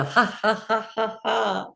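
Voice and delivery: deliberate high ha-has; exercise laughter. Shown as a demonstration. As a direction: laugh in pointed, even beats. Laughter exercise, pointing at passport.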